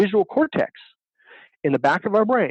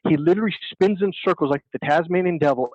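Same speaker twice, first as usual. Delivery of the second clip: ordinary delivery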